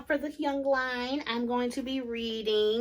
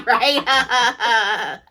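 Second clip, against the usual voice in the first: loud laughing right; real laughter carrying the question; 10 dB louder than usual. Real, not acted. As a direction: burst out laughing on the word. Laughing off a stolen joke.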